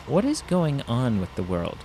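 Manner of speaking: usual speaking voice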